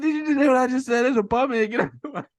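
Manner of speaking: high, loud, fast imitated warning; frantic retold shout; exasperated mock yell. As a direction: yell it like nobody is listening. Acting out ignored character repeating warning.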